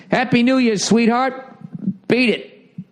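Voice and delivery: high, sing-song brush-off, "sweetheart" dripping with sarcasm; mock dismissive send-off. Shown as a demonstration. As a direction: wave her off with a sneering cheerful goodbye. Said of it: "You can't say it like that".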